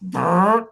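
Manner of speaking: lip-buzzed fart sound; loud imitated fart; fart noise. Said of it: "he just hears".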